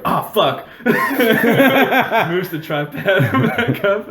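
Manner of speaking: hammed-up annoyed shout; mock yell. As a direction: yell it like the clumsy cameraman. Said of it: "then you hear the guy go".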